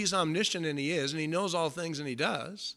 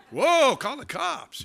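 higher, slow comic alarm; mock alarm; 10 semitones higher, 5 dB louder, slower than usual. Fan reacting to strange question, mock alarm. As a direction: gasp it like a scared fan.

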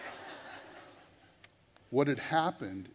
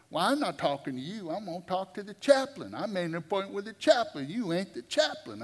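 higher, louder, pushy retort; indignant refusal; 7 semitones higher, 4 dB louder than usual. Retold or imitated. Inmate brushing off the visiting preacher, indignant and raised.

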